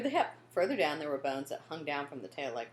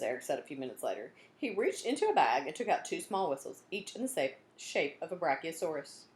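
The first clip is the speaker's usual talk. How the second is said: ordinary delivery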